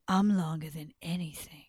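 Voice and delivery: lightly voiced low snake; boastful python.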